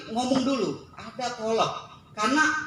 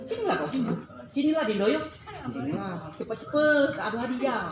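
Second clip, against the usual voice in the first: mock annoyed summons; 4 semitones higher than usual. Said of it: "Nak marah deh".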